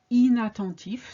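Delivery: inattentif opening on "ee" with the n sounded; clear "een" opening. Shown as a demonstration. Teacher demonstrating the rule, clear "een" opening. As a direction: say inattentif as "ee-na", not nasal. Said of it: "starts with the sound in".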